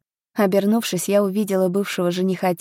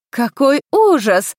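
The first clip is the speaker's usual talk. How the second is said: high, loud, drawn-out exclamation; scandalised lady's outcry; indignant; 7 semitones higher, 6 dB louder, slower than usual. A narrator's reading. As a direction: exclaim it slowly in outrage. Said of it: "произнесла дама с зонтиком в негодовании".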